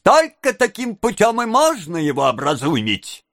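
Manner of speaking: high, busybody onlooker; gawker's shout. A narrator's reading. Gawker at attic window, calling out approval. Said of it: "крикнул из окна на чердаке какой-то ротозей".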